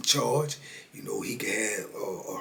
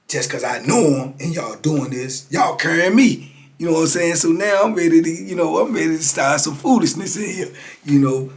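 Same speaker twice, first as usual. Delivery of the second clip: higher and louder, getting fired up; worked-up hype; 6 semitones higher, 8 dB louder than usual. Retold or imitated. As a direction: rev up like you're ready for trouble. Hyped up about starting trouble.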